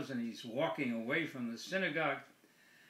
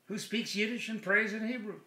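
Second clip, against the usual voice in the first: normal talking voice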